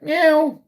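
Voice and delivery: high mock meow, imitating a kitty; cat meow. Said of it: "You can pretend to be a kitty cat".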